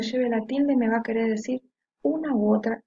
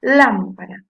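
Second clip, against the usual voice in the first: LÁM punched loud in lámpara; stressed first syllable; 9 dB louder, slower than usual. Shown as a demonstration. Teacher showing the tonic syllable, lámpara with a loud LÁM. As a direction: hit the first syllable of lámpara hard, let the rest drop. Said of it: "que suena mucho más fuerte".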